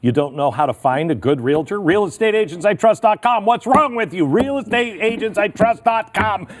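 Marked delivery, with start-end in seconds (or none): 1.8-4.3 higher, louder pitchman yelling; hammed-up infomercial shouting; mock hard-sell shout. Ad read builds to shouting. 4.3-6.5 hammed-up pitchman bellowing; hard-sell shout. Ad slogan, final shouted take.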